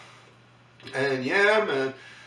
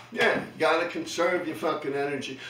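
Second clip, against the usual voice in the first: ordinary delivery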